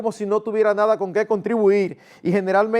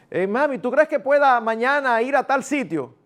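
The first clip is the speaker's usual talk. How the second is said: put-on child voice; 6 semitones higher than usual. Child asks mother again.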